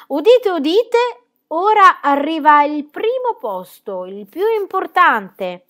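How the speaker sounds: loud, slow proclaiming call; town crier. Acted. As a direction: cry it out to the whole square. Proclaims the first place to assembly.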